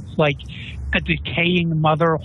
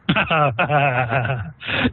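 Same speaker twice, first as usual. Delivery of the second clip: drawn-out staccato villain laugh; acted laugh. Game master voices someone heard in the room, acted laugh. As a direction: laugh as the unseen figure in the room. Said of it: "hear someone laugh kind of like that".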